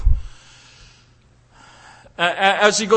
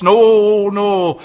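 loud, sharp refusal; booming no from the pulpit; emphatic no; 8 dB louder than usual. Acted. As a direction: shut it down firmly. Preacher's emphatic denial.

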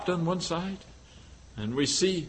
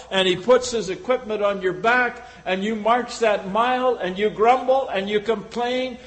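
usual speaking voice

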